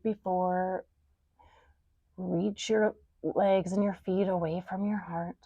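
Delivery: hushed, near-whispered yoga cue; soft guiding voice. Shown as a demonstration. Soft guided cues, whisper.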